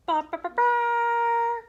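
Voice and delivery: loud, high trumpet fanfare sung on "ba"; trumpet call. Acted. Announcing the king's arrival, loud high trumpet imitation. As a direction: blast it out like a herald's trumpet.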